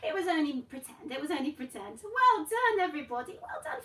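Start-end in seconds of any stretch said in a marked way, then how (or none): none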